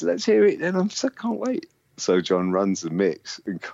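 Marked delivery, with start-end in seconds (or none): 0.0-1.7 high, eager lady voice; excited posh woman. Retold or imitated. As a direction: gush like a thrilled label woman.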